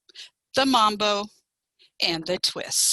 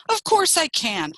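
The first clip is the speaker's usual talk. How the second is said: higher, defensive comeback; quick retort; 8 semitones higher than usual. A narrator's reading. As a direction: answer back at once, pitched up. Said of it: "Fox said quickly".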